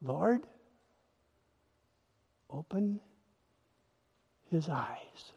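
preacher praying hushed over the listener; soft prayer. Real, not acted. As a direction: pray it barely aloud.

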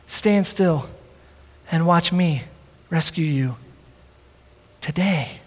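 low, soft, with long pauses; Jesus speaking gently. Acted. Jesus reassuring us to stand still, hushed and low. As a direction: let it settle quietly, phrase by phrase. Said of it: "this is what Jesus says to us".

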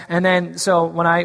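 ordinary delivery

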